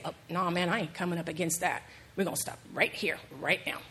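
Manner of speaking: low, soft, stubborn refusal; stubborn donkey. Retold or imitated. Preacher voicing the donkey refusing Balaam, low and stubborn. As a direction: dig in like a balky donkey.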